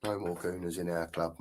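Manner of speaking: low, soft muttered agreement; quiet echo. Real, not acted. Echoing a viewer's comment back to himself, softly and low. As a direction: agree under your breath.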